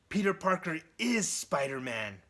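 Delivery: loud, high, emphatic revelation; triumphant reveal. Acted. Norman realising who Spider-Man is, loud triumph. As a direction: announce the secret with big punched stresses. Villain's big reveal of the identity.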